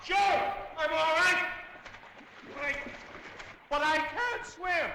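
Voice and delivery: shouting. Acted. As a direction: shout back. Shouting from the water.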